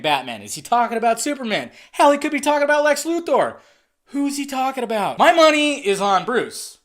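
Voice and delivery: usual speaking voice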